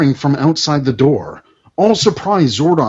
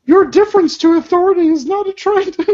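put-on high girl voice; faster than usual. Voicing a teen character.

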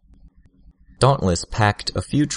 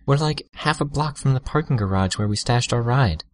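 put-on light girlish voice; 7 semitones higher than usual. A narrator's reading. Tattletale voiced by narrator.

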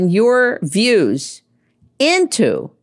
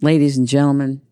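usual speaking voice

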